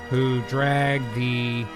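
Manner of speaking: ordinary talk, nothing marked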